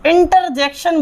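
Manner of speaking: "इंटरजेक्शन" with the R sounded in inter; students' mispronunciation. Shown as a demonstration. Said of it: "बोलते हैं विद्यार्थी इसको". ("इंटरजेक्शन" में र साफ़ बोलते हुए)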